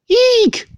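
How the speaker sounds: a sudden squeaky shriek, high and loud. Acted. Woman spots a mouse in the bookstore, squeaky high shriek. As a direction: shriek like someone who just saw a mouse. Title-like shriek before chapter.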